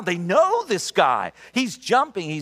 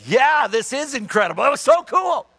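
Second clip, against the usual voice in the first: rising high and louder with joy; excited; 8 semitones higher, 4 dB louder than usual. Retold or imitated. Healed woman turns to the pastor, thrilled and high. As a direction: bubble over like she just heard again.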